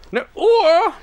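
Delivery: shrieked high and loud, rising: "Eine Uhr?"; mock outraged yell; shouted question. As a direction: scream the question again. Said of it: "Schrei mich nicht so an".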